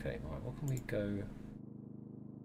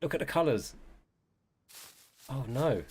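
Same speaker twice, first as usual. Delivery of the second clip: ordinary talk, nothing marked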